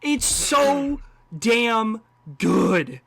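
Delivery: very slow, each word stretched out; emphatic gushing. Real, not acted. Film fan raving to co-host about the score, emphatic gushing. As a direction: savour every word with real relish.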